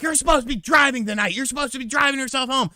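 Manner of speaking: high, fast scolding; mock scolding girlfriend.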